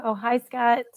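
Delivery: ordinary talk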